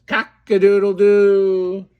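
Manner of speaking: rooster crow. Retold or imitated. Dad crows like a rooster for his toddler's lesson, loud and drawn out. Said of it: "Rooster says".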